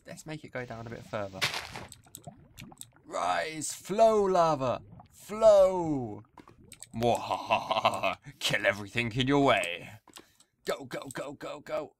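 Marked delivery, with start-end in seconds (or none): 3.0-6.2 slow, loud, higher villain incantation; mad scientist. Pretend. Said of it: "So I've gone a bit crazy". 6.9-8.2 slow, hammed-up villain cackle; evil laugh. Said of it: "So I've gone a bit crazy". 8.4-11.9 mad scientist. Pretend. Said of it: "So I've gone a bit crazy".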